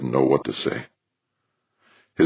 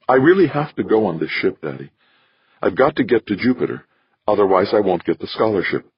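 put-on higher young woman's voice, earnest; daughter pleading; 5 semitones higher than usual. Daughter pleads with father to let her go, earnest.

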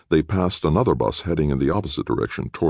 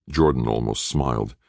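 plain reading voice

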